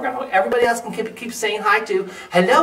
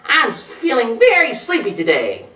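higher, mildly irritable complaint; grumpy, sleepy raccoon; snapping raccoon; 5 semitones higher, 4 dB louder than usual. A narrator's reading. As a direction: complain crossly, leaning on "very". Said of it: "snapped the raccoon".

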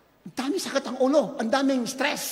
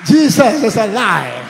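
loud proclamation climbing higher; full-force preaching; 6 semitones higher, 16 dB louder than usual. Real, not acted. Preacher proclaims living God to congregation, shouting. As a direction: begin slow and strong, build to a high shout.

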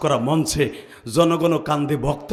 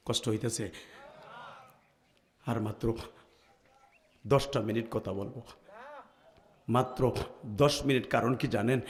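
aching chest; 2 semitones lower, 6 dB quieter than usual. Real, not acted. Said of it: "আমার বুকটা ব্যথা হয়ে যায়".

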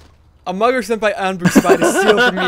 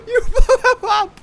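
laughing; 6 dB louder, slower than usual. Real, not acted. Laughing disbelief during chaos.

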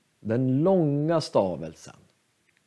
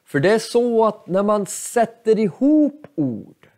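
ordinary delivery

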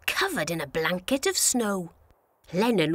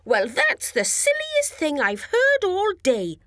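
high, amused little voice; high and amazed; Lennon voice; 8 semitones higher than usual. A narrator's reading. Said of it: "said Lennon".

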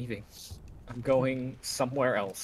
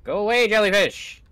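loud, higher exasperated yell; shouting; 8 semitones higher, 9 dB louder than usual. Real, not acted. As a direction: shout it at the swarm. Jellyfish attacking in game.

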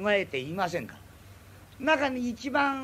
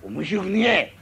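put-on sullen drunk grumble; 6 dB louder, slower than usual. Sullen drunk snaps back.

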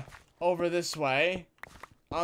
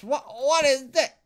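surprised; 9 semitones higher, 6 dB louder than usual. Real, not acted. Stumbles into strange cavern.

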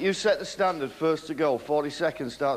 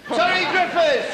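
high, slow excited shout of the name; excited call; 12 semitones higher, 4 dB louder than usual. Real, not acted. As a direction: call him out like a big introduction. Host calls out snooker player name.

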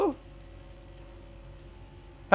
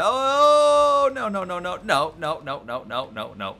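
panicked no-no-no. Real, not acted. Racer seeing a crash coming, panic fading to resignation.

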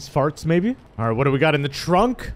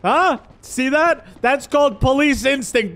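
triumphant shout; 10 semitones higher, slower than usual. Acted. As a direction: crow over the caught suspect. Cop catching fleeing suspect.

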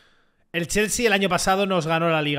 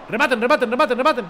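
rapid-fire, loud, raised-pitch urging; urgent calling out; 6 semitones higher, 5 dB louder, faster than usual. Real, not acted. Gamer to teammates to finish the attack, urgent calling. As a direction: call urgently to teammates, repeating.

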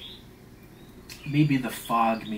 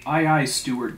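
loud, emphatic opening call; crying out; 3 semitones higher, 7 dB louder than usual. A narrator's reading. Stubb rounding on the steward, crying out. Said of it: "cried Stubb".

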